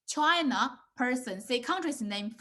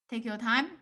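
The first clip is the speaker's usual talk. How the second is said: usual speaking voice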